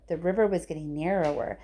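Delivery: usual speaking voice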